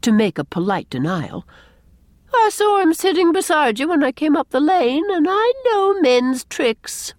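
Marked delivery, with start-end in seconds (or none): 2.3-7.1 high, emphatic retort. A narrator's reading. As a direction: answer back knowingly, drawing out the end. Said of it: "retorted Miss Cornelia".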